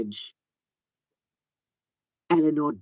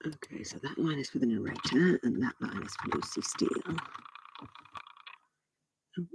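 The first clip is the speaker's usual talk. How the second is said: quiet muttering; 12 dB quieter than usual. Real, not acted. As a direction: mutter it under your breath. Quiet muttering while editing.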